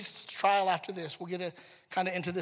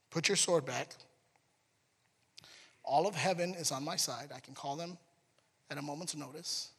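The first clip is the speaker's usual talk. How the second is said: calm gentle; 3 semitones lower, 8 dB quieter than usual. Retold or imitated. Jesus voiced calmly to Peter.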